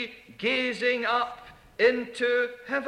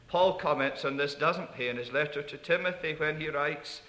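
ordinary delivery

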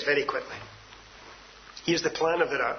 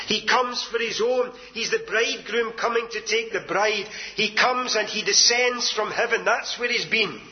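usual speaking voice

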